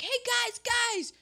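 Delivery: high, eager call to the group; mock-excited girl gathering friends. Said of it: "she's like".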